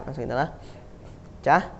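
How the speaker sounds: usual speaking voice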